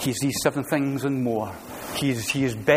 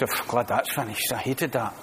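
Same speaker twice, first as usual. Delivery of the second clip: relieved grumble; 4 semitones lower, 6 dB quieter than usual. Retold or imitated. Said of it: "and just".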